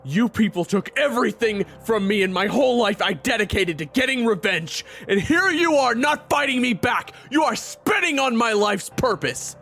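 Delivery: furious, loud outburst that keeps climbing; angry tirade. Acted. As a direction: rage at the one who wronged you. Raven rages about revenge.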